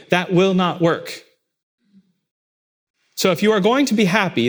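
rising sermon intensity; preaching. Acted. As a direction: press the point home from the pulpit. Preaching at full force.

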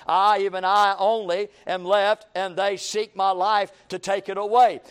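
solemn scripture read. Acted. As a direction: read the King James aloud to the church. Reading scripture aloud.

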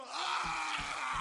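long, high, hammed-up exhale; huge satisfied roar after gulping water; loud drinker's "ahh". Imitates Samson gulping, relieved.

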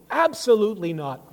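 loud, high, forceful denial; ringing pulpit rejection; emphatic negation. Retold or imitated. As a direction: punch out a total refusal. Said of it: "one of Paul's emphatic, uh, negations".

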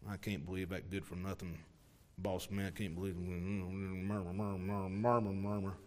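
low, soft muttered grumble; a sulky worker grumbling low; grumbling under the breath. Shown as a demonstration. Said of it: "speak under your breath".

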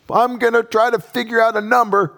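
high, mocking voice of a petty victim; mock aggrieved voice. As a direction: play the wronged man counting his due. Voicing a wronged person's scheme.

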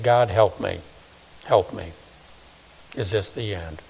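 soft, low desperate prayer; quiet plea. Retold or imitated. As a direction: beg God under your breath.

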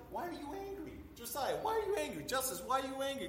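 soft, high-pitched gentle coaxing; parent asking child. Acted. Parent asks an angry little kid, soft high coaxing. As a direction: ask softly and high, as to a little one. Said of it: "one of your little kids, they get angry".

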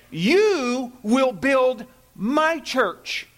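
"You" destacado, fala muito lenta; slow wrong take, leaning on "You"; stress on YOU. Shown as a demonstration. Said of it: "Neither did the Lord say".

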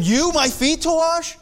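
high, startled "You, my feet to wash?"; shocked protest. Retold or imitated. Peter protests to Jesus washing his feet, high and startled. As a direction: recoil in disbelief, pitch leaping up. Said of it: "moved his feet so quickly when Jesus came".

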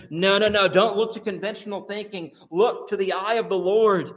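the psalmist urging trust in the Lord, high and impassioned; preaching the psalm. Retold or imitated. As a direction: plead the psalmist's words with rising fervor. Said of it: "he says".

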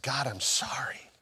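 near-whispered prayer of repentance; soft, contrite half-whisper; hushed apology. Retold or imitated. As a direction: apologise to God under your breath. Retold kneeling apology in prayer.

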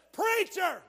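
high, loud, slow quoted shout; belting out the dead man's message; a man's last taped words, shouted high and loud; quoting the taped shout. Retold or imitated. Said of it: "tape of him standing up and said".

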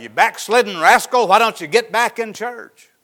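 loud, high scolding yell at a straying churchgoer; preacher berating a backslider, shouting. Retold or imitated. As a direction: bawl them out, full volume. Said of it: "you can go out and scold them".

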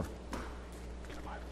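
barely audible whispered aside; real whisper. Real, not acted. Preacher waiting for Bibles to open, whispered prompt. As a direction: whisper it slowly, almost to yourself. Aside while congregation finds passage.

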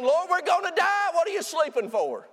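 high, frantic disciples' cry; frightened shout waking Jesus; panicked cry. Retold or imitated. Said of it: "he gets woke up by saying".

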